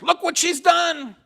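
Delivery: high, slow accusing cry; accusing crowd. Acted. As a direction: point the finger at her.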